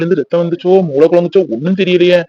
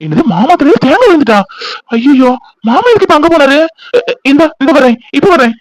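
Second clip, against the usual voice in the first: alarmed shock; 6 semitones higher than usual. Acted. Hears uncle was hurt.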